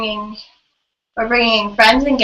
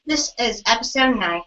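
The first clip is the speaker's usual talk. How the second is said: ordinary talk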